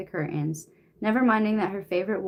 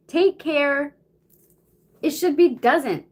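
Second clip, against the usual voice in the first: annoyed; 7 semitones higher, 6 dB louder, slower than usual. Real, not acted. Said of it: "it's pissing me off".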